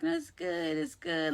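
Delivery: high, soft imitation; friend brushing it off. Hurt Kay refusing help, imitated soft brush-off.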